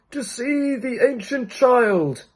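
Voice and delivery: put-on high, slow old man's voice; a slow, high, courteous old man; second old man. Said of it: "said the old man".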